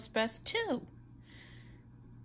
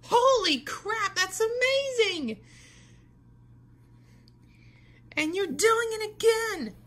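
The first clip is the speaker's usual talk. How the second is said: loud, high, wowed; amazed cheer; 10 semitones higher, 8 dB louder than usual. Acted. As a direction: shout it out in sheer amazement. Amazed at kite loop.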